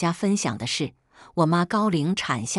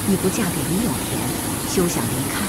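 father's angry scolding; faster than usual. A narrator's reading. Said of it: "指着我骂道".

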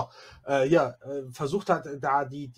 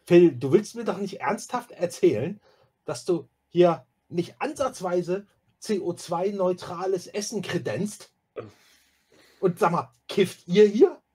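high, louder outraged questioning; scandalized stickler, pitched up; indignant confrontation; 5 semitones higher, 7 dB louder than usual. Acted. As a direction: take him to task in disbelief. Erasmus indignantly confronts Phil.

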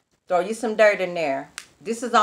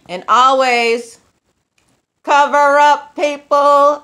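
loud, slow call to the viewers; loud emphatic; 7 semitones higher, 12 dB louder, much slower than usual. Real, not acted. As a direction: preach it out big and slow. Loud emphatic sign-off advice.

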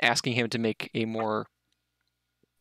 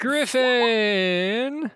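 drawn-out call; 11 semitones higher, 4 dB louder than usual. Real, not acted. Calling out a player character's name.

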